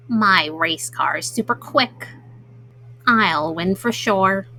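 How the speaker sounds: a smug turtle sure he'll win; boastful turtle. Acted. Mom reading to kids, Turtle boasting in a low soft voice.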